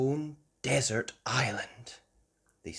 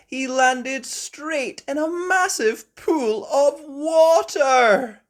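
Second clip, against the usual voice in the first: surprised splash; 10 dB louder, slower than usual. A narrator's reading. Daddy Pig lands in water.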